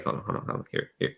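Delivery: quick whispered hold on; hushed hurry. Acted. [name] steadying Melkor toward the couch, whispered and flustered. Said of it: "I was like".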